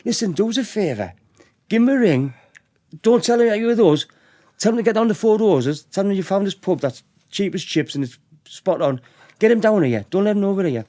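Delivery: high-pitched, over-friendly retold patter; scheming pub owner imitation. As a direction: coax the lads as if doing them no harm. Acting pub owner laying the trap.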